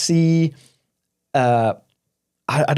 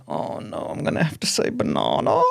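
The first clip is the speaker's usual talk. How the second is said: low, hushed mock dread; sinking inner voice, very soft; retold dread; 12 semitones lower, 6 dB quieter than usual. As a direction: murmur it as if dreading the order. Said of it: "the feeling I got".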